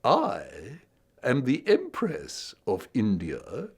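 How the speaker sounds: slow, regal queenly proclamation; Queen Victoria voice. Acted. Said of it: "in his Queen Victoria voice".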